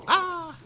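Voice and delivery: a sharp high yelp, loud and short; sudden yelp. Real, not acted. Gamer yelps when a zombie lands a hit, sharp high cry. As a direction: jolt out a high startled cry.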